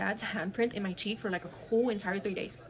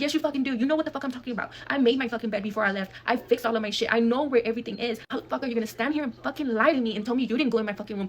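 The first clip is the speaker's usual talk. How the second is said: heated, louder re-enacted quarrel; angry retort; 3 semitones higher, 5 dB louder than usual. Storyteller confronts her cousin about the room, angry retort. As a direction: lay into the cousin, loud and insistent.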